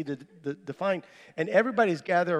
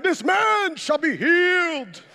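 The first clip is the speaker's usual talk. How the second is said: high, drawn-out evangelist proclamation; big TV minister; 9 semitones higher, 4 dB louder, slower than usual. Retold or imitated. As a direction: declare it big, like a TV healing preacher. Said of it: "like a big minister that's on T V".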